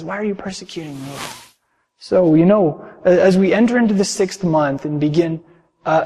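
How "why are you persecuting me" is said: quieter. Shown as a demonstration. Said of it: "He says".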